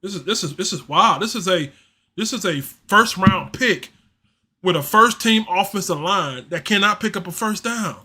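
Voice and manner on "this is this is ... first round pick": ordinary delivery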